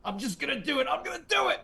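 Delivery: very high, straining hammed-up resolve; mock-heroic determination. Movie hero refusing to be talked out of it, melodramatic. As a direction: insist in an overwrought squeal.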